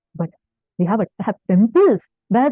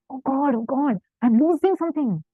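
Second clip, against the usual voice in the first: higher-pitched, acted inner lament; mock dismay; 4 semitones higher than usual. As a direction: imitate a worried devotee fretting. Imagined despairing thought of a listener.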